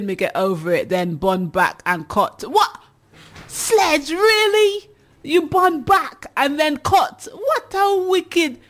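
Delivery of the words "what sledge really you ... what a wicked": high, slow, incredulous cry of disbelief; can't believe what Sledge just wrote; shocked disbelief. Real, not acted. Host reacts to shocking comment.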